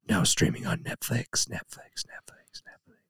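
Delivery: a whisper that dies away like an echo; fading echo. Pretend. Mock trailer echo fading out.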